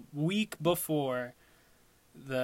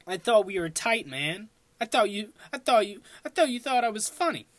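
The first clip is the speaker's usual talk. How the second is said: mock hurt; 7 semitones higher, 4 dB louder, faster than usual. Mock-hurt complaint to judge.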